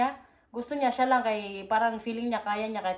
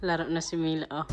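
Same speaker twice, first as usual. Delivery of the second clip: usual speaking voice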